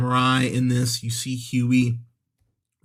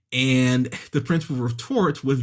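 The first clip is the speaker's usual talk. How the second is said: normal talking voice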